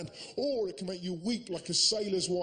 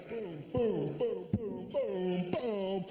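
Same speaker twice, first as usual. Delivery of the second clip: sharp shuffle beat; much slower than usual. Shown as a demonstration. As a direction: tap the rhythm out with your voice. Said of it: "it goes like this".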